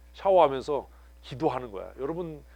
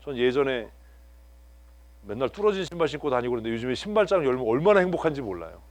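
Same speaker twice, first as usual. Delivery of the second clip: ordinary delivery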